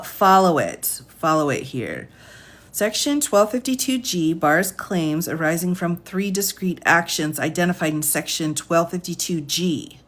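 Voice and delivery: usual speaking voice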